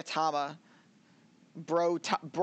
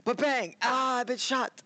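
hammy death scene; 7 semitones higher than usual. Pretend. Acting out comedy death scene.

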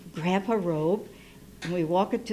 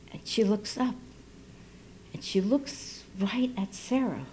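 plain reading voice

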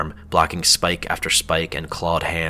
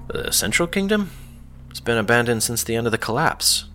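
high, disbelieving burst; surprised; 6 semitones higher than usual. A narrator's reading. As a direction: question it in disbelief. Nazrakanda's disbelieving reply.

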